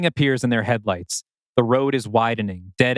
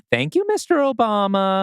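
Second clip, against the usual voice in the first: mock grateful voice; 10 semitones higher than usual.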